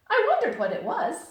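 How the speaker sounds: very high, loud bouncy hello; squeaky bright Tigger greeting. Acted. Tigger answering Pooh's hello outside the door.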